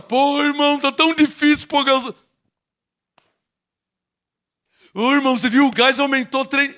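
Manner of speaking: whiny grumble, played up; mock complaining. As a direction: imitate a moaning churchgoer. Mocking complaining believers.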